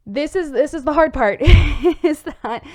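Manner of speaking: real laugh slipping into the sentence. Real, not acted. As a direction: laugh helplessly through the words. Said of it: "I don't know why I'm laughing".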